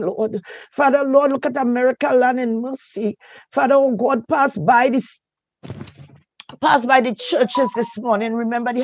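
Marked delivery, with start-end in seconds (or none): none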